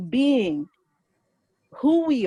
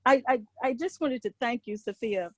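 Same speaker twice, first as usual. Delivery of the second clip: ordinary delivery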